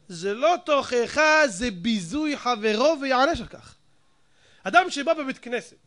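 ordinary talk

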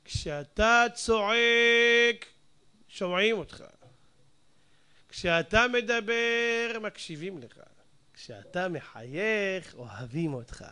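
slow, deliberate sign reading. Shown as a demonstration. Rabbi reads the pharmacy sign aloud, slow. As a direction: read each line of the sign slowly. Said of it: "במקומות ההמתנה כותבים".

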